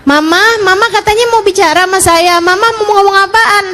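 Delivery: high, quick pestering call; child calling mum. Acted. Child asking bathing mother what she wanted, calling out. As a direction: lightly act a child calling through a door.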